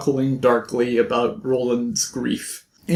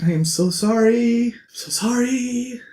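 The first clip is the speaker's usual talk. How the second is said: high, breaking, tearful apology; grieving apology; 8 semitones higher than usual. Acted. Roland holding dying Oi.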